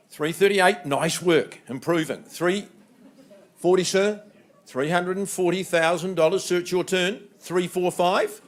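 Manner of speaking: normal talking voice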